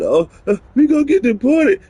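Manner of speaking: high-pitched mocking whine; mock panic. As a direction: squeal it like a ridiculous panicked worrier. Mocking people fearful of deportation.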